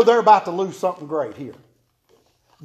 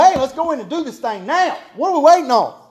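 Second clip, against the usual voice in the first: eager urging; 5 semitones higher than usual. Retold or imitated. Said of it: "Caleb's out there barking".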